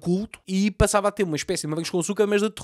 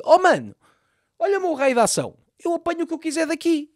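cocky boast; 10 semitones higher than usual. Retold or imitated. Voices the gunman boasting.

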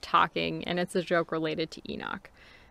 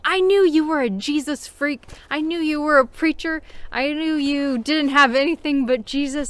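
higher, louder mock accusation; accusing stranger jeering at Hazel, higher and louder; accusing taunt; 8 semitones higher, 6 dB louder than usual. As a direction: jeer at him like a nosy stranger.

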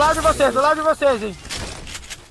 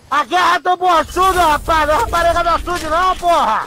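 excited shouting; 7 semitones higher than usual. Real, not acted. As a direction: shout it out in victory. Excited shouting after a win.

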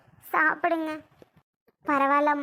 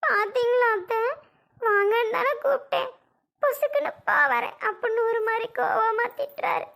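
higher, plaintive complaining voice; put-on fear; 6 semitones higher than usual. Wife complaining to her mother-in-law in a put-on scared voice. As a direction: play the frightened victim to win sympathy. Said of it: "உன் நடிப்பெல்லாம் வேற எங்கேயாவது".

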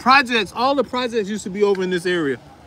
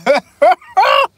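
loud high laugh; 12 semitones higher, 7 dB louder than usual. Real, not acted. Laughing at scared Lorenzo.

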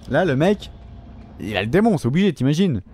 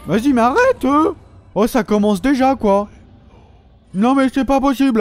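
much higher and louder, exasperated yells; gamer rage; 10 semitones higher, 5 dB louder than usual. Real, not acted. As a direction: yell at the enemy to stop. Raging at enemies.

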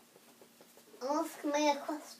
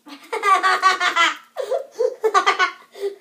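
big burst of laughter; loud laugh; 4 semitones higher, 11 dB louder than usual. Real, not acted. Laughs at embarrassed friend.

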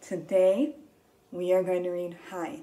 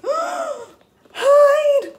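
high, loud, drawn-out exclamation; excited; 11 dB louder than usual. Shown as a demonstration. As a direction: shout it with a big burst of excitement. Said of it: "so we have to sound excited".

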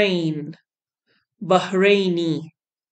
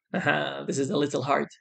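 softer, lower aside; quiet aside; 3 semitones lower, 7 dB quieter, faster than usual. Real, not acted. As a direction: drop it to a quick aside. Aside before a hard name.

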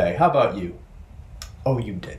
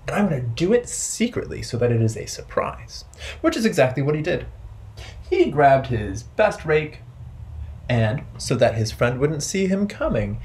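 lively storytime narration; story for children; 5 semitones higher than usual. A narrator's reading. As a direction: tell it to a circle of kids.

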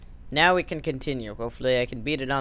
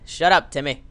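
normal talking voice

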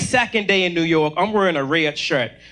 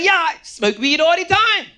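bluffing bravado; 10 semitones higher than usual. Retold or imitated. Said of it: "I was like".

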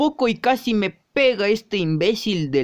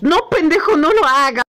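high panicked plea; alarmed protest; 7 semitones higher, 4 dB louder than usual. Acted. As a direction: beg them to stop, pitched way up. Begging not to be hit.

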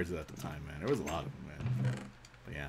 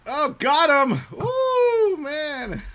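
high, loud triumphant cry; excited shout; 7 dB louder, much slower than usual. Real, not acted. Gamer hitting his target, excited shout. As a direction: whoop at landing the shot. Lands a shot in game.

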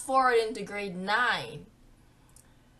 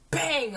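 slap sound effect; 5 semitones higher, 5 dB louder than usual. Retold or imitated. Imitating the slap.

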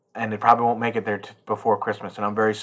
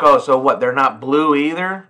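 louder sarcastic retort; mock sarcastic outrage; 3 semitones higher, 6 dB louder than usual. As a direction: scoff at the news, half-joking. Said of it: "And I was like".

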